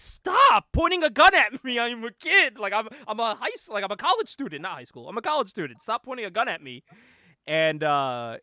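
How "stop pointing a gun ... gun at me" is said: hammed-up plea to put the gun down; protesting yell. Cornered student yells at armed soldiers, loud and high.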